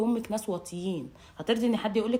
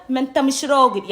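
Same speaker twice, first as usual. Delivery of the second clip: louder shouted taunt; mock loud insult; 3 semitones higher, 8 dB louder than usual. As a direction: yell the insult at husband. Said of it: "طول ما انت قاعده". (زعقة إهانة للزوج)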